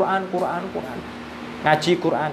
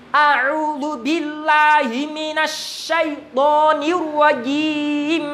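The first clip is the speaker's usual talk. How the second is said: slow, level recitation without melody, higher; plain tartil; 8 semitones higher, much slower than usual. Shown as a demonstration. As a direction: chant evenly with no ornaments. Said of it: "nagom tartil biasa datar aja".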